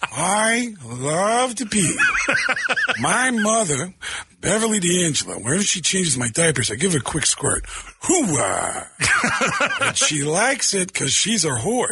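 put-on raspy baby Pacino; Al Pacino's baby. A radio bit answering the host as a Pacino baby. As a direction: Pacino squeezed into a toddler. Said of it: "Al Pacino's baby".

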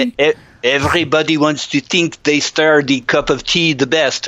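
stammered start into a hammed-up manager voice; mock foreign accent, slipping off target; bad Arsene Wenger impression. Host mimics co-host's take on the manager, bad Wenger impression. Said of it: "I was doing an impression of you doing an Arsene Wenger impression".

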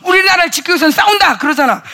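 loud, high fervent preaching; preaching at full force. Real, not acted. Preacher rousing the congregation, loud fervent preaching. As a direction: pound it out to the congregation.

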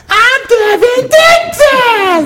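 very high, drawn-out squeal; loud, strained falsetto announcement; Devin Dixon. Retold or imitated. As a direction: shriek it as a high-pitched radio host parody. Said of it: "I'll do my best, Devin Dixon".

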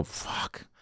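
breathless whispered curse, barely audible. Retold or imitated. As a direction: let the curse slip out as a whisper. Retelling his panicked inner reaction.

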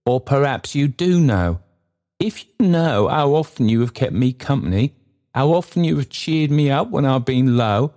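high-pitched, heartfelt pleading voice; earnest Trotty. Acted. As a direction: plead gratefully, keeping the voice high.

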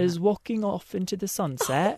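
badly faked British accent; put-on British accent. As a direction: play it mock-British. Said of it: "Can you believe my accent?".

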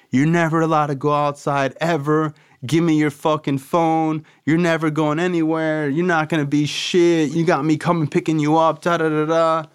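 imitating a yelling father; retold screaming. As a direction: rattle off dad's furious rant. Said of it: "My dad is screaming at me in the car".

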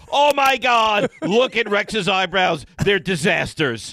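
loud, high, drawn-out mock horror; hammed-up slow outcry mimicking a co-host; mocking imitation. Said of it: "You're like".

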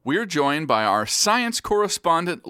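ordinary talk

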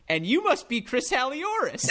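a hint of an eager parent guessing names; imitating a parent. Parent mistaking the producer for Chris, lightly voiced eager. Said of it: "points to the person next to them and goes".

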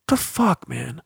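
high, incredulous outburst that drops away on man; real frustration, spiking up then deflating; exasperated disbelief. Real, not acted. Host venting at a soft podcast ending, high and exasperated.

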